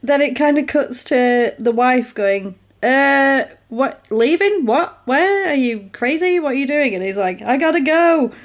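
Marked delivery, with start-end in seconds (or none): 2.8-6.9 high-pitched string of baffled questions; mock bewildered wife. Wife questions her departing husband, mock bewilderment. 7.4-8.3 urgent. Retold or imitated. Husband insists he must leave.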